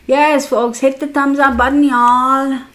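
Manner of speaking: rally the viewers to like the stream; calling out to viewers. Real, not acted. Streamer calling out to her viewers for likes, loud and upbeat.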